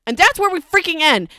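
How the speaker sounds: worked-up, exasperated reader; upset. Real, not acted. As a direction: vent your frustration at the ending. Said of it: "because I'm upset about it".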